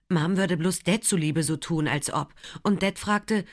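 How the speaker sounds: plain reading voice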